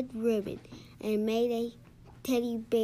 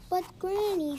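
higher-pitched, pleading objection; child's protest; 7 semitones higher, 4 dB louder than usual. A narrator's reading. Patrick objects to being sent to dreamland, protesting. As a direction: plead with Granny in a raised pitch.

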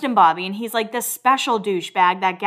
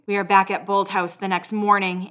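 ordinary talk

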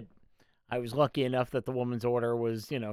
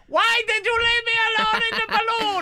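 squeaky voice breaking into laughter; high and giggling, barely holding it; high-pitched voice, laughing; 7 dB louder than usual. Retold or imitated. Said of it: "And he said".

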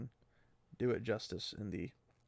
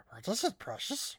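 higher, hissing Gollum-like whine; 8 semitones higher than usual. Retold or imitated. Mimicking the characters as Gollum, high creature whine. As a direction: coo it like Gollum. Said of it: "they're like".

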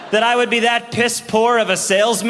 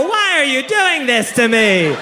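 mock despair; 6 semitones higher, slower than usual. Traveler despairs.

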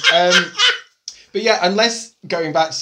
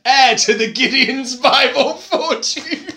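high, slow, laughing grand voice; posh heir voice; 6 semitones higher, 4 dB louder than usual. Pretend. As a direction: introduce yourself like a pompous heir. Pretends to be Gideon.

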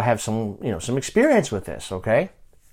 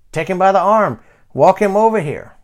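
louder, higher acted instructions; raised voice giving directions; calling out; 6 semitones higher, 5 dB louder than usual. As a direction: direct the helpers from a distance. Acted instructions called to helpers.

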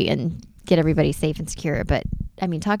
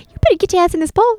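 much higher, fast mock scolding; 13 semitones higher, 4 dB louder, much faster than usual. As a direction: hurry the hamster in. Scolding hamster.

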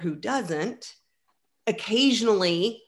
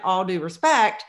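ordinary delivery

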